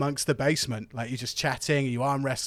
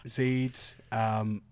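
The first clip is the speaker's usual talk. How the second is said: usual speaking voice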